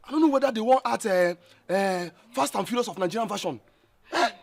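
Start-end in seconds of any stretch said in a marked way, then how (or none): none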